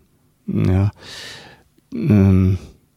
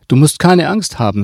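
cold, firm reassurance, pitched up and louder; higher, louder and coldly insistent; 6 semitones higher, 4 dB louder than usual. A narrator's reading. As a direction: repeat it firmly, with the smile gone. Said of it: "Ihr Gesicht passte nun zu der kalten Stimme".

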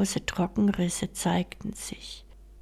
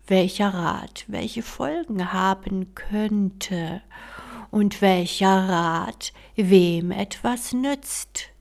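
slow weighty counsel; 4 dB louder than usual. Acted. Fairy gives weighty advice.

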